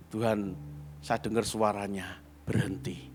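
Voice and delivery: low, soft, reverent telling; hushed. Real, not acted. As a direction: drop the voice in awe. Hushed recounting God's voice.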